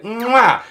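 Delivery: high smacking kiss sound; a loud high mwah like a parrot's kiss. Retold or imitated. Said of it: "she'd walk over and she'd be like".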